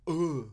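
"Ugh" as a drawn-out mock-French "euh"; French-style ending. Shown as a demonstration. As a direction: end on a long French-like vowel in "Ugh". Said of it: "you end with".

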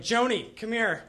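retold beckoning shout, moderately loud; calling someone over. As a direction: call her name across the gym. Friend calls girl over.

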